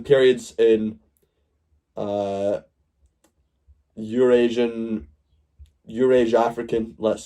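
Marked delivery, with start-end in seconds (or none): none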